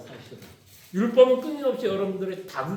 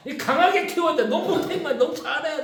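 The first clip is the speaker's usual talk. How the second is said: higher, half-laughing mock scold; mock tough parent; 7 semitones higher than usual. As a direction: play the drill-sergeant parent with a grin. Mocking tough-love parent.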